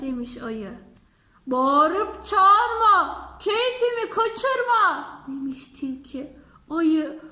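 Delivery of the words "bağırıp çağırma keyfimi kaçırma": slow, loud, drawn-out scolding rhyme. Acted. Fox scolds the bear at the door, slow and loud. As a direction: scold each word slowly and loudly.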